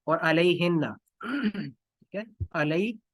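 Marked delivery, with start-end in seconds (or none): none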